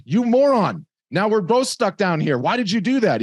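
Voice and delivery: loud, high-pitched scolding; exasperated mock outrage. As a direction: yell at a friend in acted-out disbelief. Trapped man scolds friend in story.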